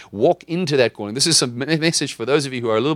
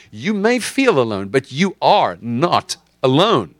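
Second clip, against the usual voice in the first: louder, higher preaching, slowing on the last words; emphatic repeat; 4 semitones higher, 5 dB louder, slower than usual. Shown as a demonstration. Preacher driving the point home to the congregation, loud and rising. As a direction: proclaim it over the room with full force. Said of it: "Let me say it again".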